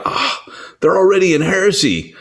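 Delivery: high-pitched, alarmed exclamation; mock shock. Speaker acting out Paul reading shocking news, mock shock. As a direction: act out a dismayed gasp at bad news. Retold shocked reaction to newspaper.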